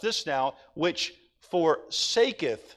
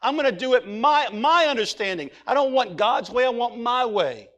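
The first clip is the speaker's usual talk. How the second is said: defiant sinner; 5 semitones higher, 6 dB louder than usual. Retold or imitated. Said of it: "and you just said".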